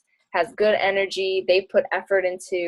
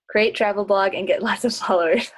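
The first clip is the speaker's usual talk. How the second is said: laughing while reading. Real, not acted. Reads a question, laughs at it.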